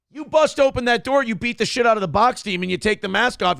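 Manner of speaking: ordinary talk, nothing marked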